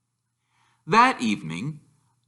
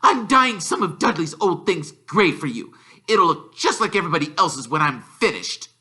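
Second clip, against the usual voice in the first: high prim aunt voice; Aunt Petunia; 6 semitones higher than usual. Acted. Aunt explaining the dyed clothes, high prim voice. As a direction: keep it curt and superior. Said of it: "snapped Aunt Petunia".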